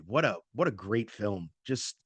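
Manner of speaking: ordinary talk, nothing marked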